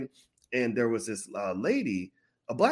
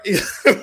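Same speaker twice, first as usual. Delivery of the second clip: laugh; 9 semitones higher, 7 dB louder than usual. Real, not acted. Laughs at own joke.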